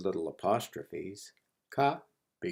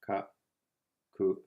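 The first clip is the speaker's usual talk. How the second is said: "Ka, ku" as two isolated beats; syllable by syllable. Shown as a demonstration. Teacher sounds out the action word, syllable by syllable. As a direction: say "Ka, ku" with a long pause between syllables. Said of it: "Finally is our action word".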